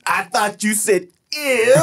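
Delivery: high, slow, laughing mock French accent; fake French accent. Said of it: "Fake French".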